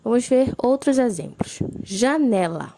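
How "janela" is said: Janela with NE drawn out and loud; stress on NE in Janela. Shown as a demonstration. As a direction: lean hard on the middle syllable NE of Janela. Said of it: "a sílaba mais forte". (Janela com ênfase forte no NE)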